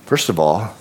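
ordinary talk, nothing marked

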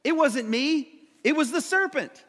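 high, loud mock-innocent denial; hammed-up passing of blame; Eve. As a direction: protest your innocence with pitch climbing. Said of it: "She says".

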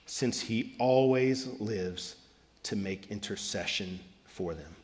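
slow, soft, solemn reading of scripture. Acted. Pastor reading Hebrews to the congregation, slow and soft. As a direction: let each phrase settle, softly and slowly.

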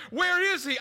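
rising panic about the missing son; panicked wife. Retold or imitated. Wife panicking over where their son is, frantic. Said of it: "She's freaking out".

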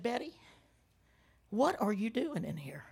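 soft, low mother's voice; retold quiet question. Mother in the cold shower to daughter, quiet puzzlement. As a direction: ask it softly, as if just coming to. Dazed mother comes back to herself.

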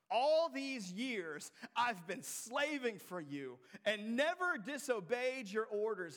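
a bitter son's grievance; drawn-out resentful protest; resentful brother. Acted. Voicing resentful older brother.

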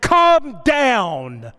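restrained re-enacted yell; retold shout. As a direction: imitate calling out to calm someone, drawn out. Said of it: "I shouted at her".

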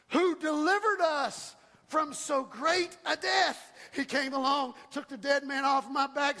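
rising, shouted preacher cadence, punching key words; preaching at full force. Acted. Pastor rallying his church, preaching at full force. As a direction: build the congregation up, voice climbing.

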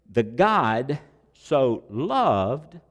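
slow verse reading. Acted. Dwells on verse again.

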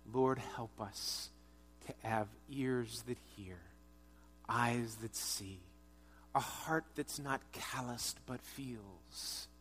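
soft, slow closing prayer. Real, not acted. Pastor praying over the congregation, soft and slow. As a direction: pray gently and unhurried.

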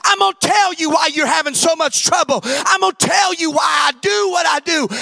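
loud, rising sermon cadence, pushing each line; full-force preaching. Acted. Pastor preaching hard to his church, full force. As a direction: preach it out to the congregation.

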